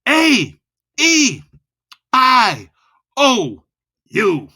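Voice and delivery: high, loud vowels; loud, high vowel blast; loud and fast. Shown as a demonstration. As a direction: jolt them with the vowels. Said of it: "He's screaming at me".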